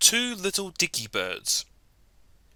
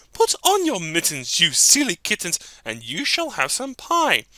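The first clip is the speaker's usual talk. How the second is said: louder motherly voice, approving; pleased mother cat; 2 semitones higher, 4 dB louder than usual. Acted. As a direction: reward the kittens kindly.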